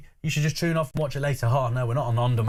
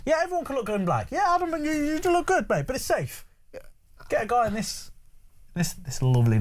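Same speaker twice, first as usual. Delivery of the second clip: high, sing-song teasing praise; butter him up with a high mocking voice; mock flattery; 9 semitones higher than usual. Teasing co-host about outfit.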